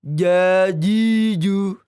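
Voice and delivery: "Jajiju" drawn out slowly, stretched and loud; wrong makhraj demo. Shown as a demonstration. Teacher shows students the wrong pronunciation, slow and stretched. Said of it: "tidak seperti ini".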